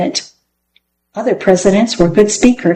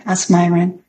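ordinary delivery, nothing marked

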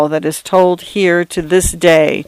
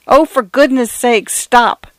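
frantic pleading; 6 semitones higher, slower than usual. A narrator's reading. Pleading with advancing water.

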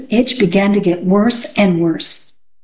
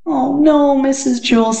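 put-on higher-pitched child's protest; high, dismayed pupil; distressed schoolgirl Dana; 6 semitones higher than usual. A narrator's reading. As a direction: plead with the teacher in a high voice.

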